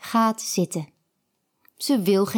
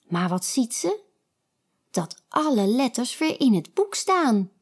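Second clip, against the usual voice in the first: usual speaking voice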